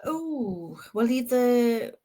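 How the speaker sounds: normal talking voice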